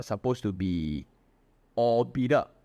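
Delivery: usual speaking voice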